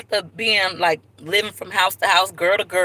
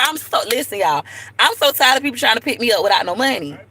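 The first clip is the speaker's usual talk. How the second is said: ordinary talk, nothing marked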